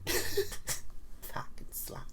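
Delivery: breathy whispered insult under a laugh; whispered aside. Real, not acted. Aside to the camera about Mary Ann, whispered. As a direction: hiss it slowly under your breath.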